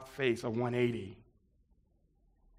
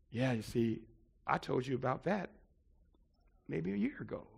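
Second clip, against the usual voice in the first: low, soft voice of a mentor; gentle correction; 7 semitones lower, 14 dB quieter than usual. Retold or imitated. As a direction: break the hard truth quietly, with pauses. Mentor gently delivers hard truth.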